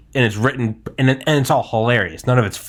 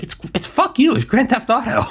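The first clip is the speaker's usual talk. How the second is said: pitched up, defensive snap; high exasperated retort; 12 semitones higher than usual. Retold or imitated. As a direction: toss it off with high indignation. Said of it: "I'm like".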